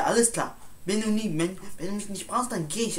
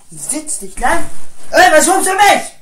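much higher and louder; aggressive yell; 8 semitones higher, 15 dB louder than usual. Acted. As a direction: square up for a fight. Fight starting. (aggressiv gebrüllt)

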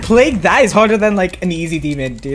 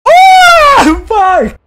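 shout; 8 dB louder than usual. Real, not acted. Sudden death in game.

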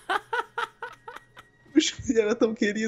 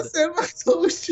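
fake crying; 8 semitones higher than usual. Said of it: "Ele tá chorando até agora".

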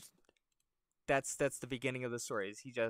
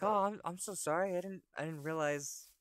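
higher-pitched flustered apology; apologetic old man; 7 semitones higher than usual. Acted. Old man sorry for ruining the razor, flustered. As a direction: apologize and stumble over the excuse. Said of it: "and he's like".

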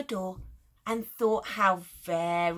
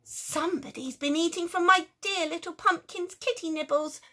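high, fussy outraged mum; indignant mummy; 7 semitones higher, 4 dB louder than usual. Acted. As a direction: sound scandalised on the cat's behalf. Mummy person complains.

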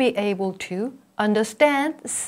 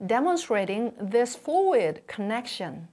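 ordinary talk, nothing marked

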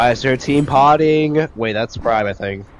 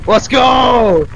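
excited cheer; 12 semitones higher, 6 dB louder than usual. Real, not acted. Cheering a win in game.

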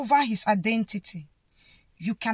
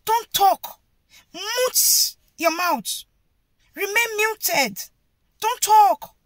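silencing order; 6 semitones higher, 4 dB louder, slower than usual. Retold or imitated. Crowd ordering Bartimaeus to stay silent, commanding. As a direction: bark at him to shut up. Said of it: "trying to tell him".

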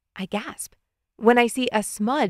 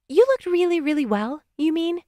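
higher-pitched sister correcting; pitched-up corrective question; 8 semitones higher than usual. A narrator's reading. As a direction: correct a brother in a high voice. Sister corrects brother's grammar.

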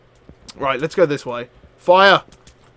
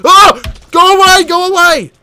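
high, loud startled yells; yelp, then shout them off in a panic; alarmed shouting; 12 semitones higher, 9 dB louder than usual. Real, not acted. Player to an attacker closing in, alarmed shouting.

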